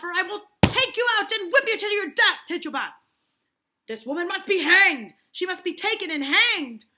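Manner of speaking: high, loud furious shout; furious threat. Acted. Parris threatening Tituba with death, loud and angry. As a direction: roar the threat.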